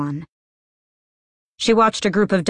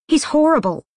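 high, louder indignant outburst; 11 semitones higher, 4 dB louder than usual. Acted. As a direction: blurt it out in outrage. Nina venting at Inej.

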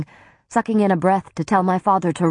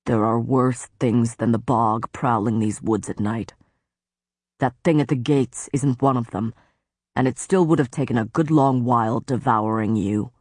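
lower, grave warning in a lord's voice; lower stern faerie lord; 4 semitones lower than usual. Acted. Tamlin warning Feyre of the woods, low and grave. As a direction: warn her darkly, as the brooding lord.

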